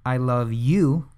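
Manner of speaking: slow, drawn-out "I love you" landing on "you"; slow phrase, "you" stressed with a fall. Shown as a demonstration. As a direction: take it slowly and land on "you". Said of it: "emphasize each different word".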